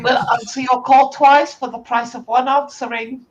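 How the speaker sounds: loud, high phone salesman; shop salesman voice. Acted. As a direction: bellow the shop greeting. GM plays shop phone answerer.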